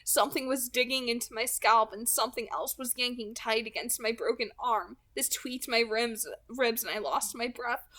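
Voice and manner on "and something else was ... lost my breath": ordinary delivery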